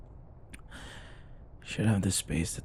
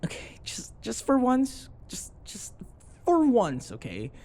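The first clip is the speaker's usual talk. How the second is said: exasperated outburst; 13 semitones higher than usual. Acted. Exasperated plea at bedtime partner.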